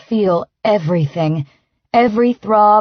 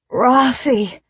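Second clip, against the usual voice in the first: moaning; 4 semitones higher than usual. A narrator's reading. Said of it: "I moan".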